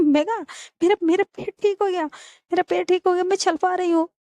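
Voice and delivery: high-pitched, excited discovery; acted astonishment to a friend; amazed relief. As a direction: announce it in delighted surprise. Acted surprise that leg is healed.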